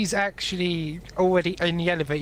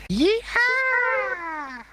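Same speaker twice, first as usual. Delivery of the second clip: very high squeaky yell; high-pitched squeaky battle whoop. Acted. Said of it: "It sounded like a very squeaky voice".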